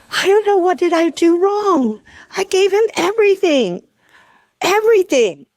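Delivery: retold crying. Retelling a crying mother's call to her, mild tearful plea. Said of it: "And she's crying. She's going".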